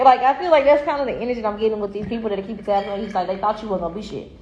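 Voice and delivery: ordinary talk, nothing marked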